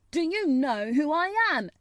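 high, loud, haughty demand. A narrator's reading. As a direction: demand it loudly, like an offended prince. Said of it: "still more imperiously".

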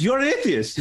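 high, incredulous "You're an atheist?" from the mum; shocked question. Retold or imitated. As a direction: voice the mum's stunned disbelief.

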